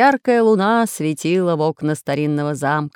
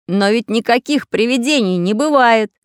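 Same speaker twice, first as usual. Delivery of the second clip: emphatic, louder, higher insistence; assuring; 5 semitones higher, 6 dB louder than usual. A narrator's reading. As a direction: persuade doubting children. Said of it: "уверял их Самоделкин".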